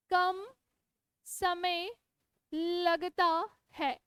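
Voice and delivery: each word set apart with pauses, slow; slow dictation. Shown as a demonstration. Teacher dictating notes to her students, slow and word by word. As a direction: spell it out word by word so they can write. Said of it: "मेरे साथ साथ लिखो".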